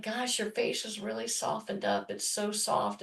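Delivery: husband softly admiring his wife's softened face; tender remark. Retold or imitated. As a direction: say it fondly, almost under your breath. Wife voices husband's tender remark.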